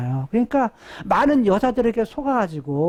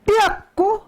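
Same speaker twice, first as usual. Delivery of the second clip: high emphatic; 10 semitones higher than usual. Shown as a demonstration. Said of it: "그래서 이런 말이에요".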